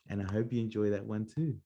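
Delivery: ordinary delivery, nothing marked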